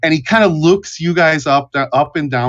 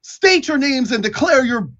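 high-pitched, flustered sentry voice; hesitant, high guard correcting himself; bumbling guard; 9 semitones higher, slower than usual. Acted. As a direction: fumble the official challenge in a high voice. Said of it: "the guard on one of the towers hails you".

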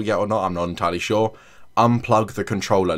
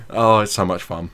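ordinary talk, nothing marked

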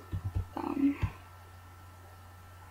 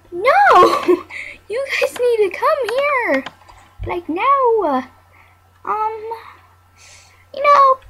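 laughing, much higher and pleading; high frantic plea; 10 semitones higher, 3 dB louder than usual. Real, not acted. Calling the resort workers over, high and frantic. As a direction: beg them urgently, voice way up. Frustrated at game guests.

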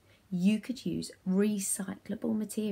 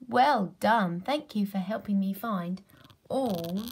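ordinary talk, nothing marked

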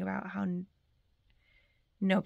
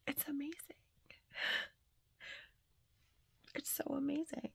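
close to tears; 8 semitones higher than usual. Real, not acted. Telling viewers a forgotten birthday was remembered, near tears. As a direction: let the tears almost break through as you thank them. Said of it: "I am about to cry on camera".

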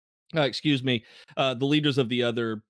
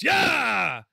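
rallying shout; 11 semitones higher than usual. Acted. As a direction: cheer them on. Rallying cry to the room.

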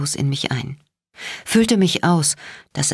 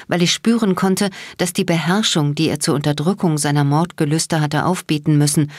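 usual speaking voice

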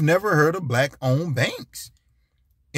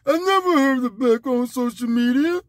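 dumb voice; 10 semitones higher than usual. Retold or imitated. Mocking people who play ignorant, high dumb voice. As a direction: act totally clueless. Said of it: "That's my dumb, dumb voice".

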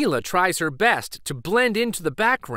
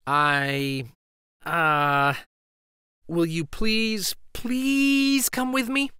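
halting plea; 2 semitones higher, slower than usual. Acted. Shy girl asking friend to come, slow halting plea. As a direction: push the words out one by one. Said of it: "struggles to get her words out".

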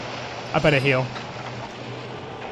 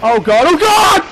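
panicked cry; 9 semitones higher, 6 dB louder than usual. Real, not acted. Panicked gamer mid-fight.

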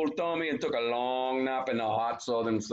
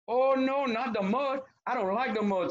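high-pitched, theatrical pleading; storybook crawfish in a panic; begging crawfish; 12 semitones higher than usual. A narrator's reading. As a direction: plead in a high voice, hammed-up dread. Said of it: "Crawfish begging him".

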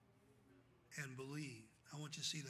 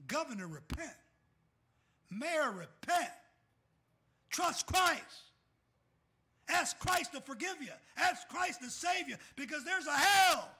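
shouted preaching; 9 semitones higher, 12 dB louder than usual. Acted. Pastor calling governor and mayor to repent, shouted preaching. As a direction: call them to repent with everything you have.

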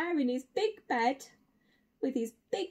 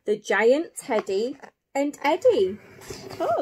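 ordinary delivery, nothing marked

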